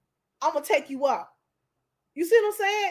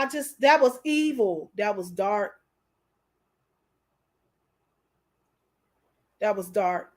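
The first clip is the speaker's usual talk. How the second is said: emotional; 6 semitones lower than usual. Real, not acted. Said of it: "I don't know why I'm so emotional".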